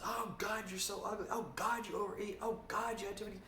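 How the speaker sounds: shrill-pitched mock self-scolding; loud harsh inner voice. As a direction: whine at yourself like a panicked critic. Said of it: "it's very loud".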